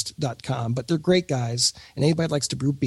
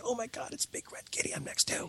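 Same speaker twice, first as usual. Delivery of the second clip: breathless starstruck whisper; awed fan whisper; starstruck kid; 10 semitones higher, 13 dB quieter than usual. Retold or imitated. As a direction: gush like an awed kid meeting a hero. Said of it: "I'd be like a kid".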